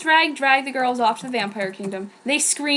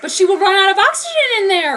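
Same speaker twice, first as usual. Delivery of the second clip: high, louder worried yell; 8 semitones higher, 6 dB louder than usual. Acted. Rio yells at the cave about Pudding's air. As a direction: shout it at the cave. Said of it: "Rio yelled at the cave".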